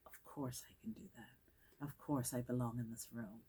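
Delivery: hushed, near-whispered self-assurance; quiet inner voice. Retold or imitated. The mind telling itself it belongs, hushed self-assurance. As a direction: say it softly, as if inside your own head.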